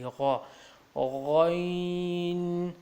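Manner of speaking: غا, then slow drawn-out غین; ghain sound. Shown as a demonstration. Teacher building the letter for learners, غا into long غین. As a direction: start غین on gha and hold it. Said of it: "یہ غا سے نکلے گی".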